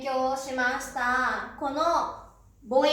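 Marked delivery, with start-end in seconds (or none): none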